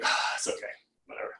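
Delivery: breathy, soft sigh of letting go; sigh of release. Acted. Meditation guide voicing someone letting go of their beliefs, sighing release. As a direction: exhale the words as if giving up the struggle.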